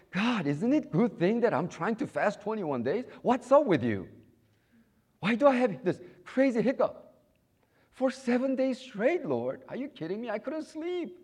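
high, loud exasperated whining at God; mock complaint to God. Preacher retelling his own grumbling prayer, high and loud. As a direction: complain to the Lord like a fed-up child. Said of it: "When I start hearing from the Lord".

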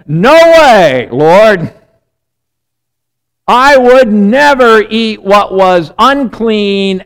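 loud, drawn-out indignant protest; emphatic refusal. Retold or imitated. Peter answers the voice in his dream, emphatic protest. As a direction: refuse loudly, stretching the words.

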